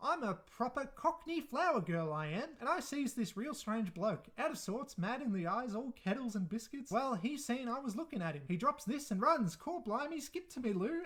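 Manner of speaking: mock Cockney lilt, overdone on purpose; Cockney flower girl put on for a laugh; exaggerated Cockney accent. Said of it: "how exaggerated her accent is".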